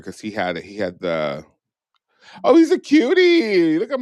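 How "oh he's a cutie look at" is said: gushing. Real, not acted. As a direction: coo over his photo. Gushing over a photo.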